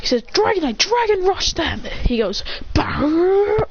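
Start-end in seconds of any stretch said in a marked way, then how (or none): 0.2-1.8 high, drawn-out attack call; battle command. Acted. 2.8-3.7 high, rolled creature cry; Dragonite noise. Acted. Dragonite doing Dragon Rush, high trilled cry. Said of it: "the Irish Dragonite noise".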